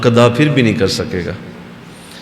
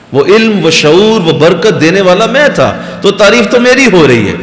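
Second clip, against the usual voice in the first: higher, insistent preaching voice; emphatic divine proclamation; 7 semitones higher, 4 dB louder than usual. Acted. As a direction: voice God's claim with mounting fervour. Preacher voices God claiming the praise. (پرجوش، بلند لہجے میں خدائی فرمان)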